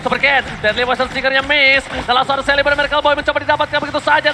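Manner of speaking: fast, loud hyped play-by-play; fighting game announcer. Acted. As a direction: call the teamfight at full tilt.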